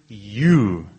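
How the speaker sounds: low, weighty "you" set apart; emphatic YOU. Shown as a demonstration. Preacher singles out the angel's word, emphatic "you". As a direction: land firmly on "you" by itself. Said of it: "he was emphasizing this word".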